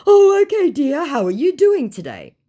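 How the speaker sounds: syrupy fake charm; mock scammer. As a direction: play the smarmy scammer. Scammer's reply voiced.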